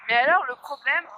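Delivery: ordinary delivery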